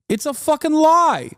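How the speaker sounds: high, loud angry cry; outraged streamer shouting at the lie; furious outburst. Real, not acted. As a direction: spit it out in disgust. Heated rant against hustle lie.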